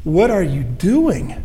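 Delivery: "What are you doing?" drawn out slowly, with a falling end on "doing"; slow searching question. Shown as a demonstration. Preacher slowly turning the question back on the congregation. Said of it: "I have asked that question".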